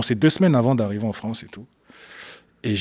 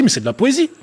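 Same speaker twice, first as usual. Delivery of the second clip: incrédule; 10 semitones higher, 4 dB louder than usual. Retold or imitated. Rejoue sa réaction devant le livre.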